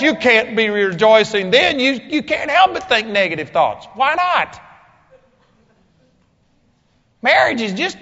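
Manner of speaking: loud, high-pitched sermon delivery; forceful preaching. Real, not acted. Preacher tells congregation marriage is only temporary, forceful preaching. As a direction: preach it hard, driving each point home.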